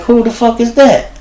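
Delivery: high, startled what-the; retold shocked outburst. Spotting a baby running around the trap, high startled yelp. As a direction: pitch it up in disbelief.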